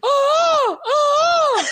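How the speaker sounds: very high squawking gull cries; screechy seagull voice. Pretend. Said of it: "We gotta hear it".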